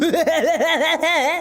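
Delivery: high, rattling mock laugh; fake exaggerated laugh. Reviewer mocking a character, exaggerated fake laughter. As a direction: laugh far too hard on purpose.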